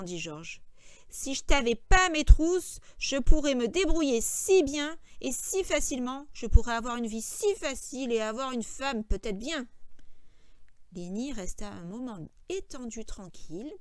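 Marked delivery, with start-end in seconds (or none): 1.1-9.7 loud complaint, easing off; exasperated George. A narrator's reading. George lamenting life burdened by Lenny, exasperated. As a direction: grumble with weight on each grievance.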